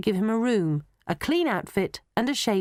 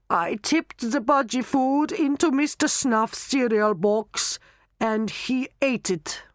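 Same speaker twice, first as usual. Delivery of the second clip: put-on higher-pitched German-accented confession; 6 semitones higher, slower than usual. Knockman listing his nasty deeds, German accent. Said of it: "you will talk in a German accent".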